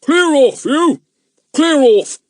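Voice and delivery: loud, high policeman's shout. A narrator's reading. Policeman shouting at children near the fire, loud. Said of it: "shouted at them".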